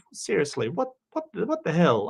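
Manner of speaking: high, disbelieving what the hell; incredulous. Real, not acted. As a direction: rise up high in mock outrage. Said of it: "I'm Australian".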